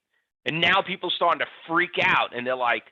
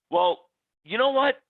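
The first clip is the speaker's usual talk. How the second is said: mocking retort; 6 semitones higher than usual. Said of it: "teach you to maybe do your homework".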